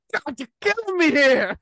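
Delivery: high, loud mock-anguished groan; hammed-up teenage despair; exasperated son.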